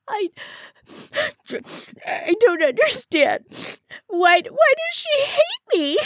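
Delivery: high, loud wail between sobs; halting, tearful plea; sobbing pitifully. A narrator's reading. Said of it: "left Iris sobbing pitifully".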